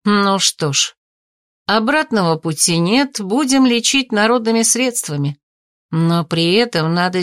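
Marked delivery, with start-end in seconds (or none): none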